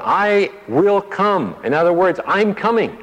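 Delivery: "I will come" stretched slowly and pitched high; "I'm coming" high and urgent; emphatic promise. Shown as a demonstration. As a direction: ring out the promise high and slow. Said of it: "is a present tense".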